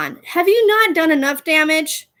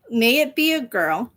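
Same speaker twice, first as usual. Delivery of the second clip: usual speaking voice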